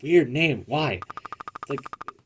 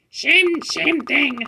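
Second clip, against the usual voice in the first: pet voice; much slower than usual. Acted. Voicing pet character.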